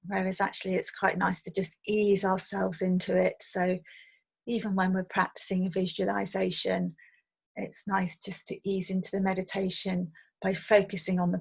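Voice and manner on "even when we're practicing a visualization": normal talking voice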